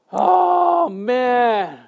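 drawn-out exasperated "oh", pitched high then dropping; high groan of mock dismay. Preacher recalling his reaction to protesters, exasperated.

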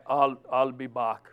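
deep, deadpan "I'll, I'll be back"; Terminator impression. Shown as a demonstration. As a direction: drop the voice low and mechanical. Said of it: "You just gotta say it right".

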